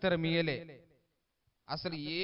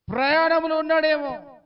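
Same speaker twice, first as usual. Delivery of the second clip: loud, higher mock concern; mocking taunt; 8 semitones higher, 9 dB louder than usual. Elijah taunting the prophets of Baal, loud mocking jeer. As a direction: jeer at them, pretending to wonder.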